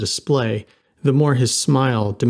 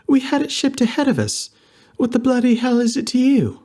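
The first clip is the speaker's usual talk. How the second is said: put-on much higher, sharp indignant girl; feisty British girl; 13 semitones higher than usual. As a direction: snap back high and indignant.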